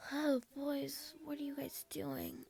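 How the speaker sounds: tired. A narrator's reading. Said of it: "I said, still tired".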